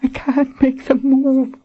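high woman's voice, desperate; panicked Colin. Acted. Wounded Colin to Richard, desperate and panicked. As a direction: plead in fear.

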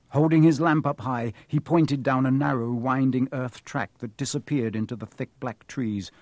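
ordinary delivery

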